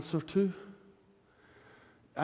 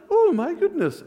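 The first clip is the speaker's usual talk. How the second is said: mock mild surprise; 10 semitones higher, 5 dB louder than usual. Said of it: "it wasn't like".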